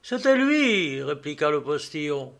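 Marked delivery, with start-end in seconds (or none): 0.0-1.0 high, emphatic exclamation; agitated confirmation. A narrator's reading. Postilion replying to the traveller, agitated and certain. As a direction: confirm it with startled conviction.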